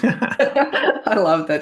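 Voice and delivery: laughing through the words, slow and high. Real, not acted. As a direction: let the laugh carry the line. Laughing at being called control freak.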